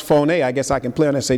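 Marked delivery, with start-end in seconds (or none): 0.0-0.3 "phone" pronounced FOH-nay, despite its spelling; two-syllable Greek pronunciation. Shown as a demonstration. Preacher correcting the English reading, "phone" said FOH-nay. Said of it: "it's pronounced phone".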